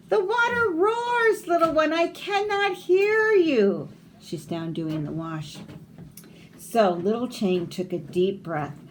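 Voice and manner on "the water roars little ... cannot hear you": much higher, raised voice over the noise; mother calling back. Acted. Mother at the wash calls back to her son, high. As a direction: call back over roaring water.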